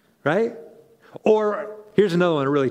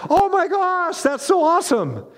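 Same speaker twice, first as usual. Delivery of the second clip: high sing-song mock admiration; mocking ungrateful people; 10 semitones higher than usual. Mocking people who never say thanks, high sarcastic gush. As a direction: sneer at them with fake delight. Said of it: "They just think".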